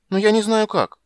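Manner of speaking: put-on high, loud child asking; childish eager question; little girl voice. A narrator's reading. (голос маленькой девочки)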